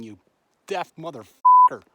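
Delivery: loud furious yell; real enraged shouted curse; angry shout. Real, not acted. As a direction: scream the insult at the bear. Yelling at the bear.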